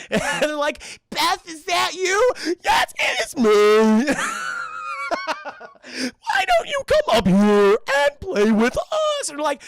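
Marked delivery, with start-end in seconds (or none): 1.1-2.3 high, loud, slow call up the stairs; anxious call up the stairs. Acted. Calling up into the dark. 2.6-4.1 loud, slow, eerie voice; the monster calls down the stairs; creepy imposter voice. Acted. Imposter [name] answers. 4.2-5.8 very high, shrieking laugh; high creepy laugh; eerie cackle. Acted. 5.9-9.3 much higher, loud and slow, sing-song; creepy luring voice. Acted. Imposter lures them upstairs.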